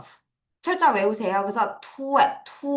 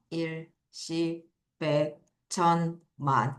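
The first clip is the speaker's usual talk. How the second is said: low, slow count with gaps; spaced-out, subdued counting aloud; counting places; 4 semitones lower, 7 dB quieter, slower than usual. Shown as a demonstration. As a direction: count 일, 십, 백, 천, 만 one at a time. Counts place values while writing.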